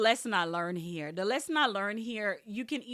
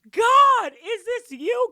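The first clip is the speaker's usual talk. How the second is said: much higher and louder, awestruck; overjoyed cry to God; 13 semitones higher, 13 dB louder, much slower than usual. Retold or imitated. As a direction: call out to God in happy disbelief. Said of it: "So I was like".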